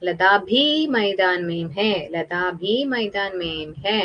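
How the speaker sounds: slow sentence with a long, drawn-out ഭീ; stress on ഭീ. Shown as a demonstration. Teacher points pupils to the new word, stretching ഭീ slowly. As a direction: dwell on ഭീ, saying the sentence slowly for learners. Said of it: "ഈ ഭീ എന്ന് വെച്ചാ".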